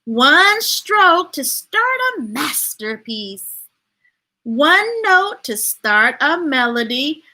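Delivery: slow, measured storybook read; unhurried read-aloud; reading to children. Acted. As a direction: let each line land for the kids. Reads picture book aloud.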